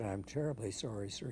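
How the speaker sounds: soft, humbled apology; acted deferential operator, voice dropped; meek apology. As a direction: apologise quietly to a furious superior.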